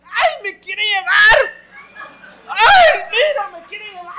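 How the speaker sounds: high, screaming panic; terrified wailing. Retold or imitated. Woman falling into the grave, screaming in high-pitched panic. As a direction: shriek as the grave pulls her in. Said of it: "and she's".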